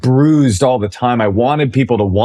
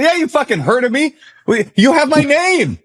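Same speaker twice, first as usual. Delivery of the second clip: high-pitched indignant protest; retold outrage. DJ confronting the man with his name, indignant high pitch. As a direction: act out exasperation, voice shooting way up.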